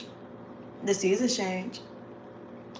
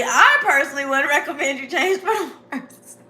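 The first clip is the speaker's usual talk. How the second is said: words breaking into laughter, slightly louder; laughing through it; 4 semitones higher, 5 dB louder than usual. Real, not acted. Giving serious advice to viewers while cracking up. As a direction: try to say it straight but laugh. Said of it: "I laugh too much".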